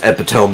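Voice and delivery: epitome said like "eppa-tome"; epitome rhyming with home at the end; mispronounced epitome. Shown as a demonstration. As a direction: say epitome as EP-a-TOME, silent final e. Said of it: "I thought it was pronounced".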